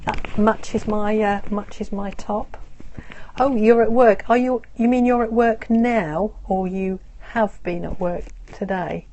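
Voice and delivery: normal talking voice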